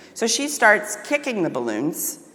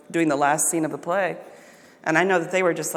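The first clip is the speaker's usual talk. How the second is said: ordinary delivery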